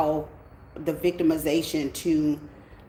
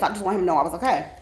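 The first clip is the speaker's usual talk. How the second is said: ordinary talk, nothing marked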